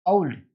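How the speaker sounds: ओल्ड with an au glide, like aul-d; correct diphthong. Shown as a demonstration. Teacher modelling the correct ओल्ड with an au glide. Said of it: "ओ में भी जो है वो औ का आता है".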